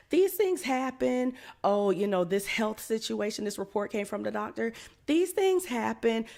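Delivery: high, loud, sing-song mock of careless comfort; mocking preaching. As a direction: preach it, mimicking the dismissive voices.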